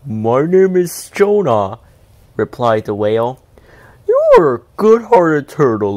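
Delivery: a big whale relieved of pain; booming grateful whale voice. A narrator's reading. Whale speaks.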